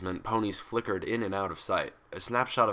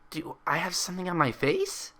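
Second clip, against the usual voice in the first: irritated; 9 semitones higher than usual. A narrator's reading. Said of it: "irritation ... seep into her tone".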